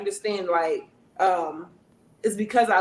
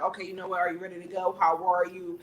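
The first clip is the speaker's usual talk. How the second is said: softer, lower check-in; understanding; 3 semitones lower, 6 dB quieter than usual. Retold or imitated. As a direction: ask gently, with no attitude. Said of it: "Instead of getting an attitude like".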